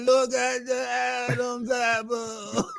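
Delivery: high, loud sing-song taunt; mock announcer. Teasing his co-host with a loud, high mocking chant. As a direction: tease him like a ring announcer.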